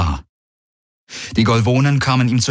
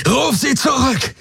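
much higher furious bellow; roaring. A narrator's reading. Soldier roars at the admiral to call off her troops. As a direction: roar the order at her. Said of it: "brüllte er".

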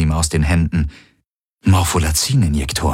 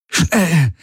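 high, strained gasp; gasping urgency; 11 semitones higher than usual. A narrator's reading. Garland hurrying them to the weapons, gasping. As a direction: pant it out as a hurried order. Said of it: "keuchte Garland".

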